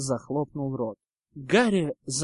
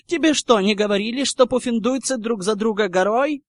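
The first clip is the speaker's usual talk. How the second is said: high, loud battle rally; shout; 7 semitones higher, 8 dB louder than usual. A narrator's reading. Ernie rallying Hufflepuff to arms, shouting. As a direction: yell it, ending on a war cry. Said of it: "закричал Эрни".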